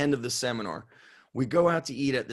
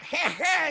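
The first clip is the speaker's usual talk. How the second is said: imitated cackle. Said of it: "It's like a cackle".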